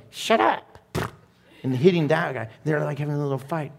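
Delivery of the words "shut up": grumpy sleepy self. Pretend. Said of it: "the other, Benji, is going".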